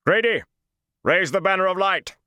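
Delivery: called out very high and loud across the camp; booming call. A narrator's reading. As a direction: make the voice boom over the battle. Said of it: "Taim called".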